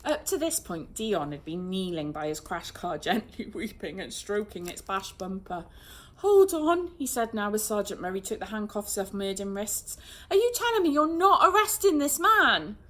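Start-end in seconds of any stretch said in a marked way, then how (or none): 6.2-12.8 high, loud indignant protest; can't believe this; outraged. A narrator's reading. Dion protesting the release, loud and indignant. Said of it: "outraged car owner protests".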